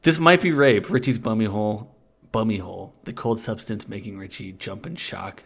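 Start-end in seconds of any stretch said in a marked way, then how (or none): none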